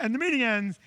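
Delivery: ordinary delivery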